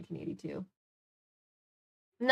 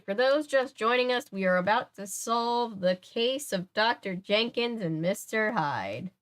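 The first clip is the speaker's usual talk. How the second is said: story host intro; 3 semitones higher than usual. Acted. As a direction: announce the case slowly, with ceremony.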